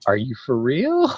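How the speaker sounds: loud, high, drawn-out question; real disbelief. Real, not acted. Reacting to the M and M's screen share, loud incredulous question. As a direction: stretch it out, rising in amazement. Reacting to shocking candy photo.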